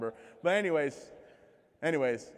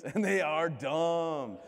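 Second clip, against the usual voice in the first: laughing; 4 semitones higher, 5 dB louder, much slower than usual. Real, not acted. Laughing punchline.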